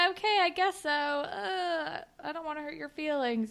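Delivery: high, softening mock reluctance; a hint of a meek girl giving in; reluctant agreement. Jenna's reluctant reply voiced.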